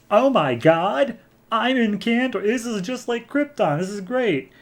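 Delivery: high-pitched gush of mock delight; thrilled amazement. Kara arriving inside the bottle city, thrilled.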